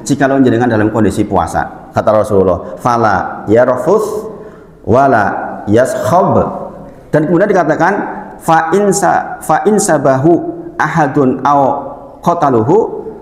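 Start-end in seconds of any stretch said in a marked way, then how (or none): 2.8-13.1 slow recited Arabic; hadith recitation. Acted. Preacher quoting hadith on fasting, slow recitation. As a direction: recite it slowly and carefully.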